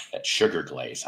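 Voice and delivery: sugar glaze leaned on with a falling tune; stress on sugar glaze. Shown as a demonstration. Host savoring the sugar glaze, leaned-on stress. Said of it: "I have to stress the word sugar glaze".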